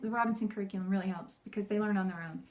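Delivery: usual speaking voice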